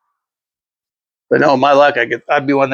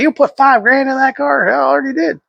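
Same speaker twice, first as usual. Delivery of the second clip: much higher-pitched mock disbelief; incredulous mock voice; 11 semitones higher than usual. People reacting to money spent on the car, incredulous. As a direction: imitate people aghast in a high voice.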